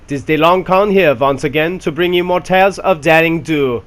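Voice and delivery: a cartoonish German tank commander greeting his crew; horrible German accent. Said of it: "my horrible German accent".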